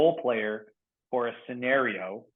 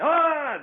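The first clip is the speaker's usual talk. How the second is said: high, loud cry of a person jolted awake; gasp-scream of someone ripped from sleep; imitating a startled scream; startled yelp; 14 semitones higher, 7 dB louder than usual.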